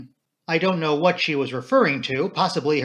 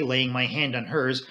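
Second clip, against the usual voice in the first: ordinary delivery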